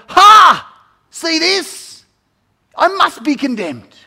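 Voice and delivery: a hammed-up crowing yell, high and loud; mock triumphant shout. A sinner shows Jesus his truckload of sins, hammed-up triumphant shout. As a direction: brandish your sins like a trophy, shouting.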